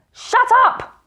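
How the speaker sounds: high, loud furious shout; furious snap. A narrator's reading. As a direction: cut him off in rage. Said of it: "interrupted the great magician".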